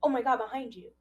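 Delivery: imitating a panicked yell of warning; retold scream. Podcaster retelling Jonah screaming a warning, mock yell. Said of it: "And then again".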